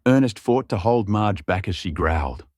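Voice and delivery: ordinary delivery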